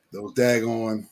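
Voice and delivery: ordinary talk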